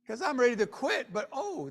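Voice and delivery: usual speaking voice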